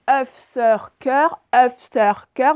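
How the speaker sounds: œuf, sœur, cœur avec un E bouche ouverte; E ouvert. Shown as a demonstration. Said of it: "ma bouche, elle est ouverte". (œuf, sœur, cœur : E bien ouvert)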